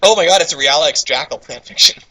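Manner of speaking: ordinary talk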